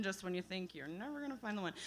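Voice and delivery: a quiet, wry repeat of the line; wry quiet callback. Real, not acted. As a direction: murmur it as a dry punchline. Rueful reprise.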